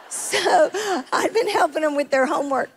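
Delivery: amused punchline with a laugh. Real, not acted. Joking about helping with homework, laughing. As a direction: talk through leftover laughter. Laughs through her own joke.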